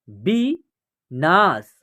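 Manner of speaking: विनाश drawn out, syllables apart; slow syllable by syllable. Shown as a demonstration. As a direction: read विनाश slowly in parts. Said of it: "या आप direct भी पढ़ सकते हैं". (विनाश धीरे-धीरे टुकड़ों में)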